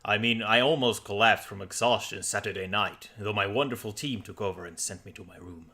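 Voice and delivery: a hint of a low, sensual Viking; lightly voiced Viking with faint Danish; deep Viking voice. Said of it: "deep Viking voice with only the ghost of a Danish accent".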